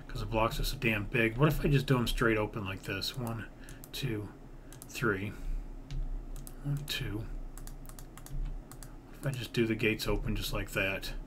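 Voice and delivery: ordinary talk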